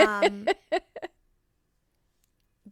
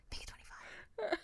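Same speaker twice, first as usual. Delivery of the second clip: urgent high whisper; 12 semitones higher, 10 dB quieter than usual. Retold or imitated. Wife whispering urgently to her husband about the tip. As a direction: hiss it urgently across the table.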